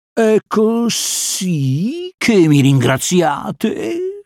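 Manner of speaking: slow, high-pitched serpent whine; drawn-out whiny lament; complaining snake. A narrator's reading. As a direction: drag out the complaint like a wounded snake. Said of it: "si lamentò Kaa".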